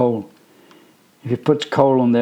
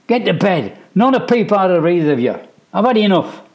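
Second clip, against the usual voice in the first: loud, high exasperated command; fed-up order; 8 semitones higher, 4 dB louder than usual. A narrator's reading. Mum sends the boys to bed, fed up and loud. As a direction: end it firmly.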